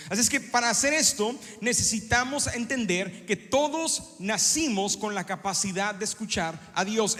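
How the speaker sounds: normal talking voice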